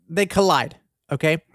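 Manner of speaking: ordinary talk, nothing marked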